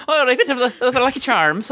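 high-pitched cartoon Irish voice; mock Irish accent. Teasing a friend's botched Canadian accent, mock Irish accent. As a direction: put on a silly, high Irish brogue. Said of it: "I did an Irish accent".

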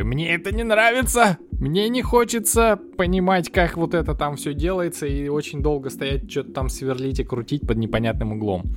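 high, fed-up complaining rant; annoyed rant. Retold or imitated. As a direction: whine it out, fed up and irritated. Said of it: "начинаю беситься и такой".

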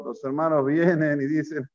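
ordinary talk, nothing marked

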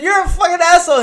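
high, loud mock outrage at his friend; mock angry. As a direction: yell it half-laughing at the prankster. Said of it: "I said".

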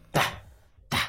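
"ta" with a let-fall T; lone "ta", T falling away on a breath; dropped T. Shown as a demonstration. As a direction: drop the T softly into "ta". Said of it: "dejas esa T ahí caída".

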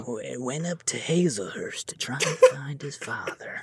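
hushed, breathy documentary narration; soft narrator voice. Retold or imitated. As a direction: whisper it like a dramatic narrator. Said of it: "He talks so soft".

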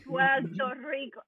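slow, exaggerated Puerto Rican "Puerto Rico" with an L: "Puelto Rico"; mock Puerto Rican accent. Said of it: "yo odio cuando hacen eso".

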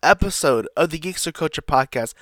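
big announcer-style welcome; podcast host intro. Acted. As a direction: open the show like an announcer.

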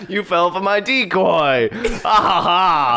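high, slow gloating villain with drawn-out laugh; cackling villain. Acted. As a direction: crow over your trick, then cackle. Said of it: "they hear cackling".

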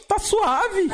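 squeaky chill voice; high mock voice. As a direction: pitch it way up, laid-back. Same quote in high put-on voice.

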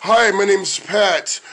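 very slow, deliberate mock introduction; Pat. Host gently mocking his oversensitive co-host, slow impression. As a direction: draw it out, teasingly kind. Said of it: "we'll do Pat in a kind way".

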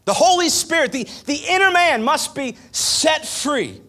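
high, loud preacher's cry; preaching at full force. Acted. Pastor to congregation, loud preaching cry. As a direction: preach it out to the church.

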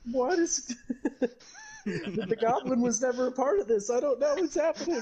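high, panicked confused voice; baffled and lost, voice up high; bewildered. Retold or imitated. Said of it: "and she's just".

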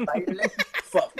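slow, high, through laughter; drawn-out name reveal with a laugh. Real, not acted. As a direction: announce the name while breaking into laughs. Laughs revealing chosen drag name.

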